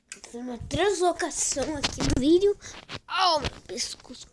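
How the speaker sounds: loud, high excited outburst; excited yelling. Real, not acted. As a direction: yell it out in a rush. Startled game moment.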